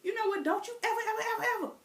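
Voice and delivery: scolding grandma. Retold or imitated. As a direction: hammer the warning. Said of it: "It'd be like".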